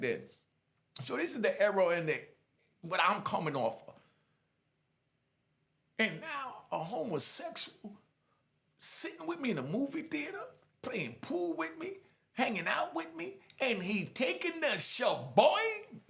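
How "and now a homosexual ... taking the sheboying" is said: slow, high-pitched disbelieving questions; incredulous outrage. Retold or imitated. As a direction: lay out each offence in disbelief, pausing between. Replaying his incredulous outrage.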